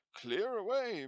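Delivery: put-on "Clear away" roared by a jovial old man; hearty jovial shout. A narrator's reading. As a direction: bellow "Clear away" like a jolly master. Fezziwig calls his apprentices.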